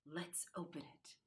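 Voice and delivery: soft, low conspiratorial; hushed suggestion. Acted. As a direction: keep it secret from mum. Boy secretive suggestion.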